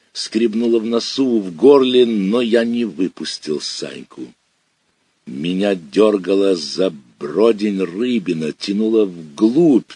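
ordinary delivery

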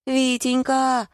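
high, drawn-out wheedling call; fawning. A narrator's reading. Wife sucking up to her cross husband, fawning. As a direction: coo the pet name slowly, up high. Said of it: "залебезила супруга".

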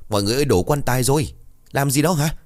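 frantic yell as the coffin falls; alarmed shouting. A narrator's reading. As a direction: call everyone over in fright. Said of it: "thi nhau la lớn".

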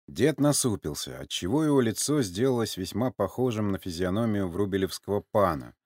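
usual speaking voice

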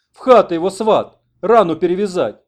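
loud, high, straining croak; hoarse shouted orders. A narrator's reading. Sick man orders his in-law to carry the wounded in, hoarse orders. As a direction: rasp out urgent commands. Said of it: "прохрипел он".

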